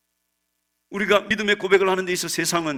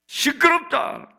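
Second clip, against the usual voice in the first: high, slow, drawn-out rebuke; raised-voice hushing of a crier; scolding shout; 10 semitones higher, 4 dB louder, much slower than usual. Acted. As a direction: shut the noise down, high and stretched. Crowd rebukes the shouting blind man.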